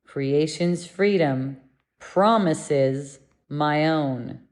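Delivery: slow, deliberate affirmation; measured lesson line, drawn out; slow recitation. Real, not acted. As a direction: recite it slowly, phrase by phrase.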